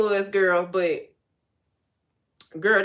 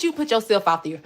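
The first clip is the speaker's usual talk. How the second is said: usual speaking voice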